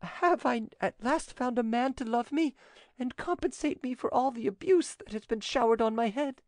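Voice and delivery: high heroine voice, overcome with gratitude; broken, grateful voice. A narrator's reading. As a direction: pour out thanks in a sustained high pitch. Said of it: "she said in a broken voice".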